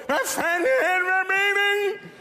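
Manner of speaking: high-pitched, drawn-out mock yell; råbende komikerparodi. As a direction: holler it slowly in a high put-on voice. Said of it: "mest kendt for at råbe". (hånligt efterlignet råb)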